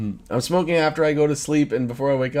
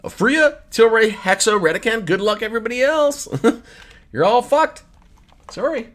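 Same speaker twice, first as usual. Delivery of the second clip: high, loud, gloating roll call of winners, laughing at the end; gleeful gloating; 10 semitones higher, 5 dB louder than usual. Retold or imitated. Reacting to Tilray buying Hexo, high gleeful gloating. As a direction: crow over the merger like you just won. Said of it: "I was like".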